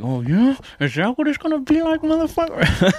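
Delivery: high, riled-up mock challenge; mock angry taunt. Fighter snapping back after a hit in the face, mock angry. Imagined angry retort in ring.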